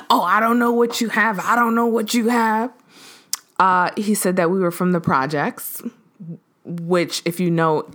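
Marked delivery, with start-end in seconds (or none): 0.1-2.7 mocking his offense. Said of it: "he was immediately offended".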